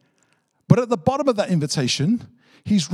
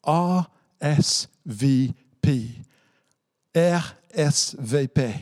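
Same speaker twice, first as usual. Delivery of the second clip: 2 slow spelling; slower than usual. Shown as a demonstration. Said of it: "She writes at the bottom".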